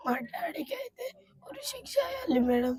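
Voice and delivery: barely audible tearful whisper. Real, not acted. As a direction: breathe the words through tears. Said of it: "ఏమొద్దా". (కన్నీటితో గుసగుస)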